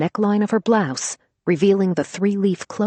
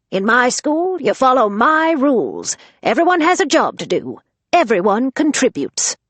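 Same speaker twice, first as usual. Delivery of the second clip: strict rules; 8 semitones higher, 5 dB louder than usual. Acted. Grandma lays down house rules.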